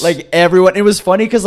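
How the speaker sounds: normal talking voice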